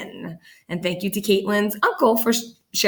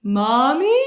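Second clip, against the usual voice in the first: higher rising call; worried question; 5 semitones higher, 4 dB louder than usual. Acted. Boy calls to mother squashed under the moose. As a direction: check if she's alright.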